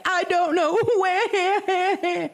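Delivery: high-pitched exaggerated boo-hoo wail; mock sobbing. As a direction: imitate a sobbing caller, high and whiny. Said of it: "She starts this sobbing".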